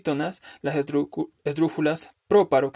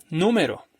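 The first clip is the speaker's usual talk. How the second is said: Número stressed on NÚ, falling away; stress on first syllable; 2 semitones higher than usual. Shown as a demonstration. Teacher shifting the tonic syllable, Número stressed on NÚ. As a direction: hit NÚ at the start of Número. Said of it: "vaya cambiando la sílaba tónica".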